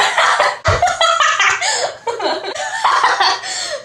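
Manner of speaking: long high helpless giggling fit; long giggle. Real, not acted. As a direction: laugh at yourself, can't stop. Giggle fit mid-confession.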